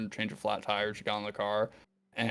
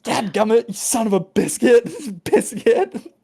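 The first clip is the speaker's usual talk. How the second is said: higher, louder, slow laughing exclamation; mock cursing shout; 11 semitones higher, 9 dB louder, slower than usual. Characters forced to avoid cursing, hammed-up shout. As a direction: swear with biscuit, hammed-up.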